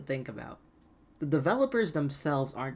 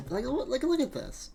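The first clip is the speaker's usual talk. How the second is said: incredulous; 10 semitones higher than usual. Real, not acted. Disbelief at bad trailer footage.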